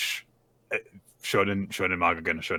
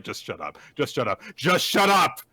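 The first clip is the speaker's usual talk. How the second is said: each shut up stronger than the last; building irritation; 4 semitones higher than usual. Retold or imitated. Snapping at an apologizing classmate.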